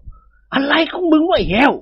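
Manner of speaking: high, slow, alarmed outburst; jolted by the servant's scream; startled. Acted. เจ้าคุณตกใจถามเจ้าแห้ว. (ตกใจถามเสียงสูง)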